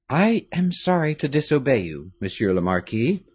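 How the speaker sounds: usual speaking voice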